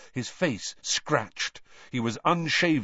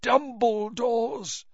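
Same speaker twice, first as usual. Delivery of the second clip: halting, much higher strained words; terrible effort; 12 semitones higher, much slower than usual. Acted. Crouch strains to speak to Harry, halting. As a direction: wrench each word out.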